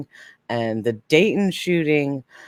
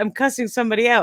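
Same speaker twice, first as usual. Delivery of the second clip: high, quick mock protest; imitated indignant friend; puzzled protest; 7 semitones higher, faster than usual. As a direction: rattle it off, baffled by the praise. Friend's puzzled reply acted out.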